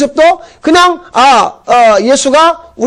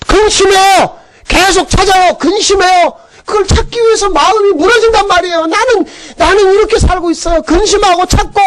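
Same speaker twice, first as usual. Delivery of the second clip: 높고 힘 있게 외치는 설교; 신자들의 근심을 토해내듯 높게; 열정적인 설교; 7 semitones higher than usual. Acted. 설교의 절정. (높은 목소리로 힘차게 외치는 설교)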